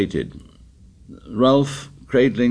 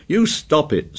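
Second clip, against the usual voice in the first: shrill; 8 semitones higher, 3 dB louder than usual. A narrator's reading. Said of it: "said Piggy shrilly".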